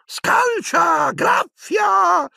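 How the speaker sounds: very high, drawn-out gleeful yell; Peeves, gleeful shout. Acted. Poltergeist goads the brawling elves, yelling merrily. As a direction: cheer on a fight in a piercing squeal. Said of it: "gridò Peeves allegramente".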